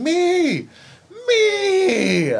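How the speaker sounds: very high, loud showboating yell; exaggerated look-at-me shout; yelling, self-centred. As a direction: crow it out, drawn out, mocking a glory hog.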